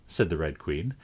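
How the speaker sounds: ordinary delivery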